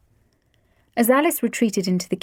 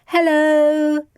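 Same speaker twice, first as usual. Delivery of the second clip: high, louder dutiful greeting; obedient chorus; 8 semitones higher, 5 dB louder than usual. A narrator's reading. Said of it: "chorused obediently".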